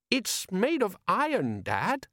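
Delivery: high, slow wondering voice; baffled son piping up; young son. Acted. Son describing the strange object.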